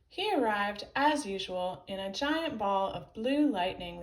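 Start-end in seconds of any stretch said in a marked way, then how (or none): none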